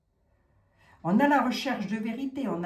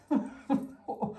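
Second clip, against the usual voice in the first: breathy giggle; 5 semitones higher than usual. Real, not acted. Laughs at her own pun.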